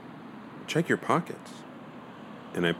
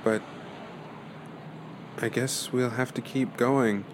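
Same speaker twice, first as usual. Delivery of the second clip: normal talking voice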